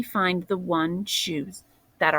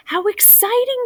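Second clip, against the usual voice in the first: bright, much higher grandma voice; excited Grandma; 4 dB louder than usual. Acted. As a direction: gush with delight over the find. Grandma sees the shoes.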